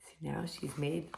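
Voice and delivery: usual speaking voice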